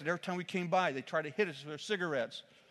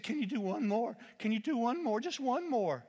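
rising, pleading urgency, higher each time; urgent pleading; 7 semitones higher than usual. Retold or imitated. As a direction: beg him urgently for one more. Man urgently begging preacher.

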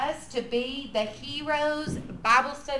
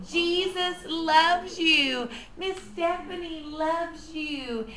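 slow, higher, lilting sweet talk; talking to a baby; 3 semitones higher, slower than usual. Shown as a demonstration. Teacher speaking to a baby after a song, sweet and slow. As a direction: coo it slowly, phrase by phrase. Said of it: "every song you sing with that baby, You are gonna say".

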